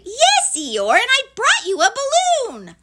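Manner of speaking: very high, loud little shout; piercing small-creature outburst; insistent Piglet. Acted. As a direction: squeal it out, dropping on the last word. Piglet exasperated, insisting loudly.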